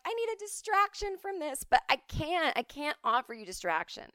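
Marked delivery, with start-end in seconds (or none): 0.0-1.6 higher, put-on whine of a bored viewer; whiny viewer. Viewers begging her for distraction, mock whine. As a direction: whine it like a restless audience. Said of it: "all y'all are like".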